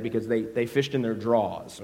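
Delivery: normal talking voice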